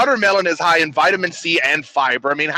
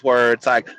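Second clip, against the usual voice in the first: ordinary talk, nothing marked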